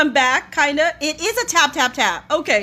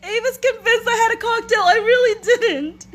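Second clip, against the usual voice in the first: higher voice wobbling with laughter; breaking up through the words; crying with laughter; 5 semitones higher than usual. Real, not acted. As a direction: protest while still giggling. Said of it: "I'm crying".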